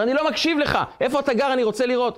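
high-pitched, indignant demand; mock-cross, refusing to hear a word; acted angry refusal. Driver demands to know where boy lives, acted anger.

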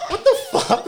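high-pitched stunned disbelief, half laughing; shocked. Retold or imitated. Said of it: "I was kinda shocked".

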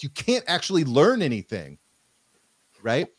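usual speaking voice

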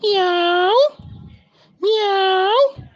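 very high, thin-held storybook meow; cat's meow. A narrator's reading. Voice the goats hear on the road, meowing high. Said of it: "una voz que decía".

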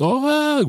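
high, held mock wail; imitated pained cry. Father imitating daughter as her scraped knees sting, mock wail. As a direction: mimic a child wailing in pain. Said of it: "She was screaming and crying".

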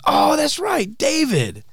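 squeaky high oh that's right; high friendly recognition. Retold or imitated. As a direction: pitch up, friendly. Kevin's quote, very high.

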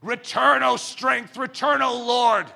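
loud, high, pleading cry; shouted prayer. Acted. Preacher crying out for the Lord's return, shouting high. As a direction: cry it out to heaven.

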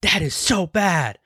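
wife disapproving. Retold or imitated. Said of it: "She's like".

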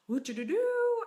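high playful howl; howling call. Shown as a demonstration. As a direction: howl it out to your tribe. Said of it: "If you can howl".

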